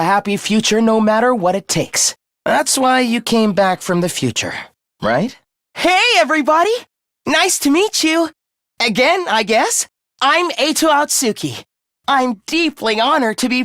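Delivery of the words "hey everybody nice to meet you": bright, higher-pitched hello to the group; cheery greeting. Acted. Newcomer introducing himself to the Special Defense Unit, cheerily. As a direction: greet everyone with upbeat pep.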